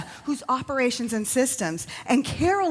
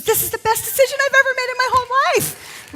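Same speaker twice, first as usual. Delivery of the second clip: high, louder beaming voice; imitated rapture; 13 semitones higher, 6 dB louder, faster than usual. Quoting the woman's delight at the event, high excited. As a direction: sell it like a starry-eyed convert. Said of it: "she goes".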